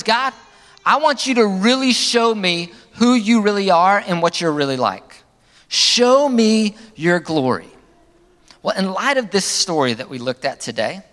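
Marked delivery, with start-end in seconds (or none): 0.0-7.7 high, loud, slowing right down at the end; Moses' earnest plea. Retold or imitated. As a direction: plead with God, dragging out the last words. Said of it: "In other words".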